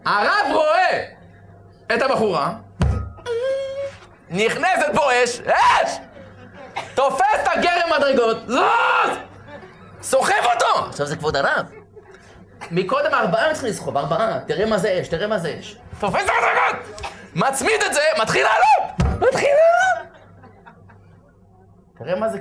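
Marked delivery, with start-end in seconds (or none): 0.0-10.9 rabbi overcome by desire, storming the stairs; building frenzied shout. Acted. Preacher acting out the rabbi's lust, frenzied shouting. Rabbi seized by fire, dramatized. 16.0-20.2 high, loud, wild cry; frenzied shout. Acted. Rabbi hauling the staircase upstairs, high frenzied shouting.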